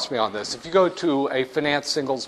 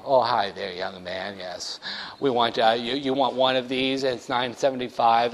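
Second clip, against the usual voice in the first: put-on lower, soft old-lady cashier voice; 4 semitones lower, 5 dB quieter than usual. Cashier Mabel greeting the buyer, low and soft. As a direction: croon like a kindly old cashier.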